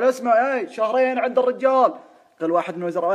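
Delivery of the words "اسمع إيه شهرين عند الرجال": raised voice. Retold or imitated. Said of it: "يرفع صوته".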